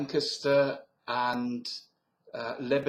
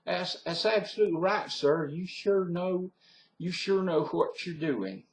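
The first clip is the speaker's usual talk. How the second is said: Southern US accent; 6 semitones higher than usual. Shown as a demonstration. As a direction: praise him like an impressed Southerner. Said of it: "so that's my southern accent".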